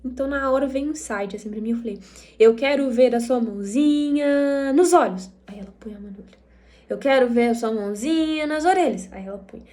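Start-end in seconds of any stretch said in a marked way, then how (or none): 2.4-5.3 singsong, loud and drawn-out, landing on the body part; playful command to child. Retold or imitated. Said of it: "entra na brin-, na, numa brincadeira". 6.9-9.1 singsong game voice, a bit louder; playful command to child. Retold or imitated. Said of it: "fazer a brincadeira mais".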